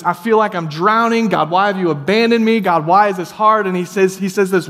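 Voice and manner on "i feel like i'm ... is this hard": louder, higher cry of despair; anguished psalmist. Acted. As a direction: plead with God as if drowning. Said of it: "He goes through this".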